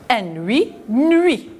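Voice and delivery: teacher sounds out N huit, nuit; blending into nuit. Shown as a demonstration. Teacher to children, blending N huit into nuit. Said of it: "On a la lettre muette".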